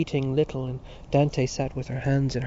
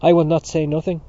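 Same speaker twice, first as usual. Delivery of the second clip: loud, raised-pitch refusal; said loudly; 4 semitones higher, 10 dB louder than usual. A narrator's reading. Dante refusing to be quieted at table, loud. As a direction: refuse forcefully to be hushed. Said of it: "Dante said loudly".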